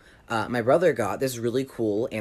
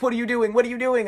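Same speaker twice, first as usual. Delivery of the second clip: high, alarmed repeated question; panicked; 11 semitones higher, faster than usual. Retold or imitated. Bully pinned by the window, high panicked questions.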